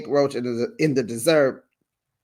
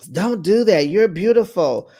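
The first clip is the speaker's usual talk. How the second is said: higher, louder admonishing; mock reproach; 5 semitones higher, 5 dB louder than usual. Vlogger quoting a cast member to another, mock reproach. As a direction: tell her off in a raised voice.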